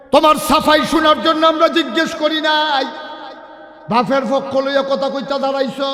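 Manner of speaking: loud, high angry demand; stern elder's rebuke. Acted. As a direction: cut off the excuses and demand an answer. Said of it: "এক মুরব্বী কয়". (চড়া গলায় রাগী ধমক)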